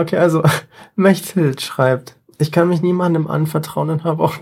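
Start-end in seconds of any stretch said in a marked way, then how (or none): none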